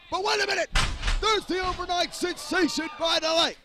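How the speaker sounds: excited. Real, not acted. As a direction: call the big move. Excited play-by-play call.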